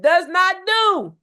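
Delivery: high, loud, slow scripture line; preaching. Real, not acted. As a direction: drive the verse home from the pulpit. Quoting scripture, preaching build.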